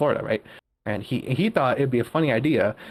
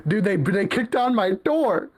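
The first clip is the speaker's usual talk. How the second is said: imitating a tearful boss in a higher, strained voice; higher-pitched wail, retold for laughs; mock crying; 6 semitones higher than usual. Friend imitating his boss upset over the broken door, mock tearful. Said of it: "he started crying as soon as he mentioned the door".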